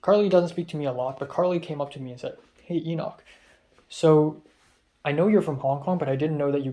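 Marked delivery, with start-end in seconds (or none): none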